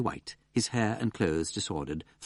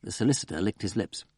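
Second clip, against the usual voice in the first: plain reading voice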